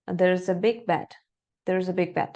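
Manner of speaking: there's a big bed run together smoothly; connected speech. Shown as a demonstration. Teacher modeling fluent linked English. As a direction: link "there's a big bed" into one flowing phrase. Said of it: "va a sonar como está acá".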